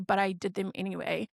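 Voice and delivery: ordinary talk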